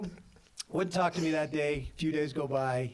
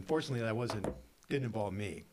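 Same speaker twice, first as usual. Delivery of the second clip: ordinary talk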